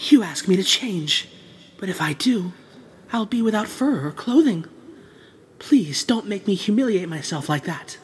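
soft, trembling plea; frightened, quiet begging. Acted. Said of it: "a trembling human voice".

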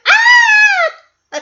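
high, loud squeal of delight; excited shriek. Retold or imitated. Fan imitating DJ's squeal over her new phone. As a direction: shriek like a thrilled teen. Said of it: "She's so excited".

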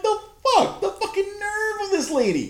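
high, loud, laughing disbelief; incredulous laughing yelp; laughing outrage. Retold or imitated. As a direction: cry out, half laughing. Retold reaction, laughing.

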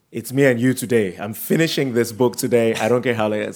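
pushing on, loud and insistent; determined self-talk. Retold or imitated. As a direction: declare it firmly, as if to yourself. Acting out a determined reader's resolve.

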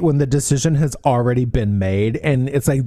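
ordinary talk, nothing marked

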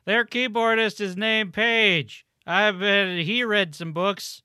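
slow, high joking announcement; mock intro. Joking about band member.

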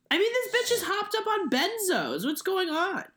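high, put-on mocking voice; silly clown. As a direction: play it like a goofy clown. Mocking Kellyanne, far voice.

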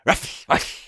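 voiceless, hissing double bark; whispery ruffs with a snake's hiss; snake dog voice. Acted. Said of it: "do the voice for Snake Dog".

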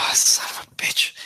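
low whispered son of a bitch; whispered curse. Acted. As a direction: breathe the curse under your breath. Player mutters after sigh.

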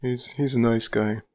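lightly voiced sheepish admission; imitating sheepish backtracking. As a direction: retell it with a touch of sheepishness. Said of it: "sheepishly afterwards and was like".

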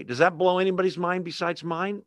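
ordinary talk, nothing marked

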